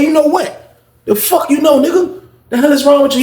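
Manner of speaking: high, heated challenge; angry confrontation. Acted. Storyteller acting out squaring up to the little dude, angry. Acts out confrontation before a fight.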